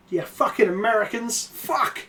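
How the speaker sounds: usual speaking voice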